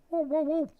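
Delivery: tiny high-pitched whoas, quick and even; high little motion noises; playful whoa. Pretend. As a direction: squeak out the movement sound effect. Voicing the moving graphic.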